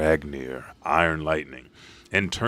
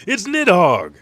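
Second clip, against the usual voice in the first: startled cry; 9 semitones higher, 6 dB louder than usual. Retold or imitated. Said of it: "someone says".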